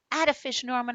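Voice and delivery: high, loud cheer. Acted. Boy cheering his goldfish, high and loud. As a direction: cheer your fish on proudly. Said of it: "I cheer".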